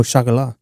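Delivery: Chocolat corrected to "sho-ko-LAH"; French-style pronunciation. Retold or imitated. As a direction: insist on Chocolat with SH and a silent T. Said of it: "You mean chocolate?".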